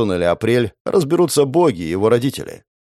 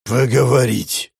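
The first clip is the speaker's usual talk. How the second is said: slow, grinding metal voice; rusty metallic voice; slower than usual. Acted. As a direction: scrape it out like rusty armor. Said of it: "проскрежетал ржавый металлический голос".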